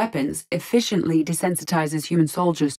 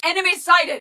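high-pitched enemy alert; urgent callout; 11 semitones higher than usual. Acted. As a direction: warn the squad of a sighting. Spotting the enemy.

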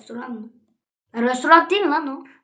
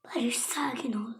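slow whisper to oneself; hushed, unvoiced-style self-talk; self-calming whisper; 14 dB quieter than usual. Real, not acted. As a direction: whisper it slowly, calming yourself down. Whispers to himself to calm down.

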